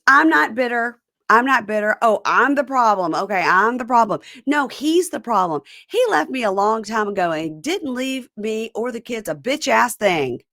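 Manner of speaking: imitating an emphatic, worked-up denial; defensive rant. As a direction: act out someone bitterly protesting they're fine. Retells Joella's defensive rant.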